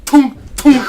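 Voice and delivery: high, loud "tonk" knocks; two punchy pecking noises; imitated double peck. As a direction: hammer out a beak strike. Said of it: "and just went".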